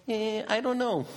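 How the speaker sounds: high, dismissive shrug of a voice; shrugging doubter. Retold or imitated. Onlooker shrugs off a miracle, high and dismissive. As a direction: toss it off like an unimpressed onlooker.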